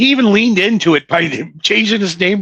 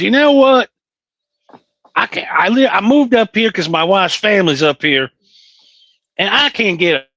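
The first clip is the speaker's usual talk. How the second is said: put-on Southern accent; 2 semitones higher than usual. Retelling what a coworker from the auto auction said, in a Southern accent. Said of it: "It's 'cause I talk like this".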